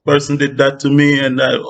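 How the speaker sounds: hammed-up heartbroken venting; mock hurt lament. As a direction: play the wronged friend, voice rising in disbelief. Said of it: "you're crying, and you're like".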